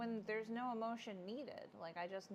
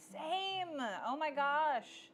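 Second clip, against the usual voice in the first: excited agreement; 6 semitones higher, 5 dB louder, much slower than usual. Real, not acted. Sharing crying over Steve video.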